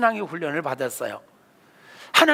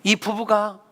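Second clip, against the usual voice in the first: ordinary talk, nothing marked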